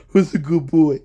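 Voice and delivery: put-on loud doting good-boy voice; pretend pet talk. Streamer greeting a cat he hopes to win over, playful pet talk. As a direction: gush at an imagined pet.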